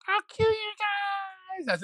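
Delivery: very high squeaky little voice; shrill tiny creature threat; tiny spider voice. Acted. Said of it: "a little spider voice".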